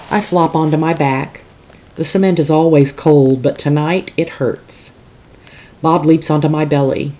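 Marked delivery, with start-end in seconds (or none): none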